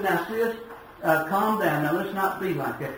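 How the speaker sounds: gentle brother calming his hot-tempered sister. Retold or imitated. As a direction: hush her gently, settling her down. Brother soothing angry sister.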